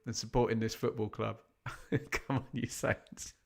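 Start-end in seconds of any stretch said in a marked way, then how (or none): 1.7-3.5 soft, helpless real laughter through the words; laughing. Real, not acted. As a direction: cheer weakly while laughing. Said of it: "I'm sorry, I'm laughing".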